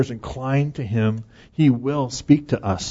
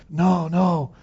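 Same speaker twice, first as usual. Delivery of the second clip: raised, drawn-out protest; playful higher-pitched pleading; mock pleading; 6 semitones higher than usual. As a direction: plead lightly against it ending. Not wanting fellowship to end.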